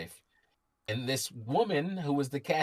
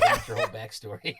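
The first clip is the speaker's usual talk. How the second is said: laughing sarcasm; 4 dB louder, faster than usual. Real, not acted. Mock exasperation at the cashier.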